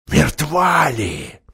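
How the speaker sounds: slow, eerie grey voice; grey dreadful voice. A narrator's reading. Wolf-man questions if the witch is dead, slow eerie voice. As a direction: speak slow and menacing. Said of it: "Тот серый ужасный голос".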